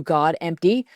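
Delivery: ordinary delivery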